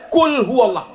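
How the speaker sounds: "Qul" said as "kul" with a plain k; mocking mispronunciation; wrong pronunciation. Shown as a demonstration. Preacher joking about a misread verse, mock wrong. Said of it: "makanlah Allah itu".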